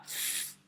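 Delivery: "f" as a long voiceless hiss of air. Shown as a demonstration. Teacher demonstrating f without vocal cords, airy fff. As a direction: blow out a bare fff with no voice. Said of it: "bunyi f yang tidak bergetar".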